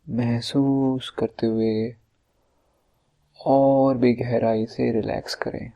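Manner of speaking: slow, drawn-out meditation voice with long pauses; guided meditation. Acted. Leads a relaxation meditation.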